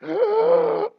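loud, high drowning gasp; sharp gasp for air. Shown as a demonstration. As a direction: suck in air like you just broke the surface. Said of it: "I had to gasp for air".

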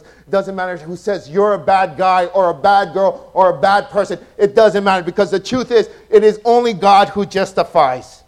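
loud, driving sermon climax; full-force preaching. Acted. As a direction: preach it out to the whole room.

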